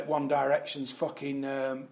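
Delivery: ordinary delivery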